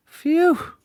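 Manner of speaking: high-pitched relieved phew. A narrator's reading. Mister Jelly seeing only a twig, relieved. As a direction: let the fright out in a high puff.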